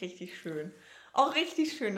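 usual speaking voice

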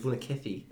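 Vunakesi with a lisped S, like th; lisped pronunciation. Shown as a demonstration. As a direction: Vunakesi said with a soft lisp on the S. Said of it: "say it like you have a lisp".

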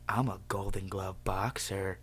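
low, slow, soft impression of a drunk; imitated drunk man's voice. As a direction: mimic his slow, low boast. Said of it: "That's how he talks".

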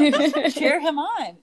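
high, loud laughing. Real, not acted. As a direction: laugh through it, delighted. Laughing about cheering baby.

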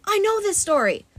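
high, urgent exclamation; sudden realisation. A narrator's reading. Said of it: "Zoe said suddenly".

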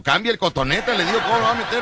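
high, laughing outrage over the swab; laughing protest. Retold or imitated. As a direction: squeal it out, amused and appalled.

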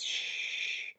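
a quick unvoiced shh; breathy sign-reading shush; whispered shush. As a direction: hush them like the sign.

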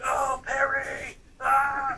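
slow high drawn-out jeer; mock teasing shout. Teammates jeering at him through the curtain, mock shout.

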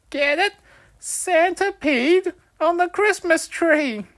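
very high, loud and slow; milk the joke with glee; excited punchline again. A narrator's reading. Wolfie repeating his punchline, very high, loud and slow.

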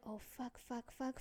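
very soft repeated cursing; retold secret dread, barely voiced; hushed panic. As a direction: curse under your breath, trying to hide. Acts out panic while hiding.

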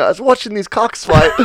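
lightly put-on British storyteller voice; imitating a British man. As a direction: mimic a British guy through suppressed laughter. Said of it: "the way he says it is".

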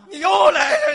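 loud, high comic outburst; hammed-up indignant shout; mock exasperation. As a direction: explode at a repeat offender in mock outrage. Straight man answers to "son" again.

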